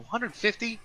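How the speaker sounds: high rising disbelief on Hundred fifty; shocked question. Retold or imitated. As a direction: balk at the price. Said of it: "I go".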